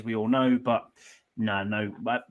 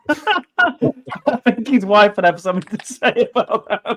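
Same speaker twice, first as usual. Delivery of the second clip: slow, high words breaking up with laughter; laughing; 8 semitones higher, 5 dB louder, slower than usual. Real, not acted. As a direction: speak through laughter, pitched high. Reacting to a crude joke.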